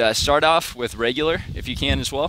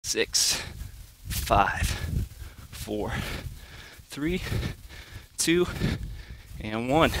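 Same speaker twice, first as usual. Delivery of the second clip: quiet, strained counting mid push-up; counting workout reps; 2 semitones lower, 6 dB quieter, much slower than usual. Real, not acted. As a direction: count reps under your breath while working. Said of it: "Starting to feel it a little bit".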